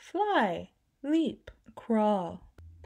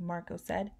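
ordinary delivery

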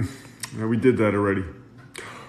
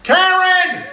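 loud calling shout; 14 semitones higher, 5 dB louder than usual. Pretend. Dad calling out for Karen, loud shout. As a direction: bellow the name across the house.

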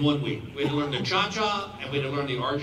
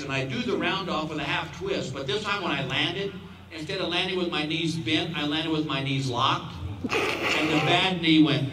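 ordinary delivery

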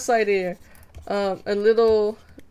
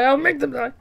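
higher, teasing villain reply; mock defiant; 5 semitones higher, 6 dB louder than usual. Streamer teasing chat that she will kill them, defiant. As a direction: flip it back gleefully.

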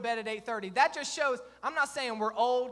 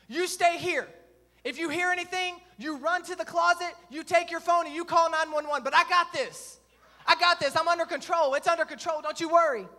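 loud, higher-pitched take-charge orders; man-of-the-house bravado; 5 semitones higher, 7 dB louder than usual. Retold or imitated. Husband ordering his wife to safety, hammed-up loud bravado. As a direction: play the protector, firm and forceful. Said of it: "show her that I'm the man of the house".

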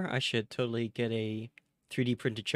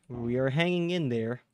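usual speaking voice

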